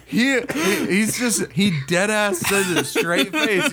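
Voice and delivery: high, laughing through every word; words squeezed out between laughs; real giggling retelling. Real, not acted.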